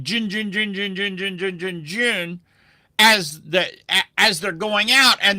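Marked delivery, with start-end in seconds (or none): 0.0-2.4 soft repeated jun like numbers whizzing out; imitated fax noise. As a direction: mimic a fax machine, quietly. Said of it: "you could see all the numbers going".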